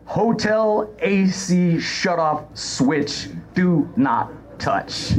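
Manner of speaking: slow, deadpan sign reading; solemn warning-label read. Retold or imitated. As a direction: read it out slowly, stressing each word. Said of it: "it says".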